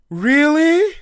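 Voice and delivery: loud, high drawn-out question; over-eager disbelief, acted out; mock amazement. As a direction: imitate a thrilled friend, pitch leaping up. Retold friend's amazed reply.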